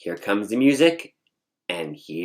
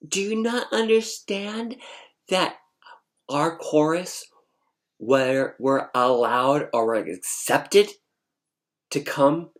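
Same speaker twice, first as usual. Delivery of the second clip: higher, emphatic rhetorical questioning; indignant attitude; 5 semitones higher than usual. Retold or imitated. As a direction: hammer the point home in short, pointed bursts. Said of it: "with this attitude".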